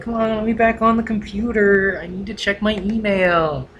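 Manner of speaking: high, whiny put-on plea; mock whiny pleading. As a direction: nag to get the computer back. Begging back onto the computer.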